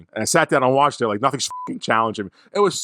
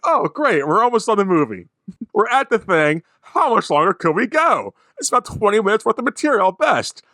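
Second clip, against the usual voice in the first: higher, mocking running commentary to the dog; sarcastic grumbling; 10 semitones higher than usual. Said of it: "I'm like".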